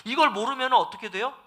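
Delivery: ordinary talk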